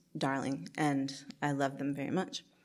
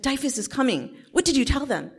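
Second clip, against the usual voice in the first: frantic mom; 10 semitones higher, 5 dB louder than usual. Retold or imitated. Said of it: "She's like".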